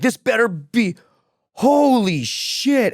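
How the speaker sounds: high, very slow dawning shock. Retold or imitated. Coworker seeing the broadcast, high slow shock. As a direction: let the shock stretch it out, high.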